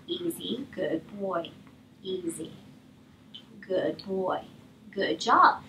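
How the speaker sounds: very soft, low soothing words; near whisper to dog. Real, not acted. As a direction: hush the dog gently near the rabbit.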